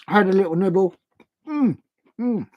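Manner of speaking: exaggerated mm of munching on cheese; mock eating noises. Shown as a demonstration. Showing how he'd score while eating, munching hums. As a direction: hum as if nibbling something tasty. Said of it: "eating a little bit of cheese like this".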